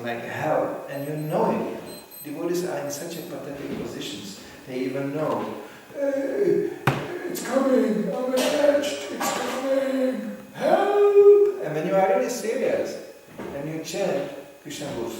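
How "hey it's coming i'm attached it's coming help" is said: high, slow, rising into a loud cry for help; mock panic. Devotee feeling attachment coming, mock panicked cry for help. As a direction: play the helpless addict calling out.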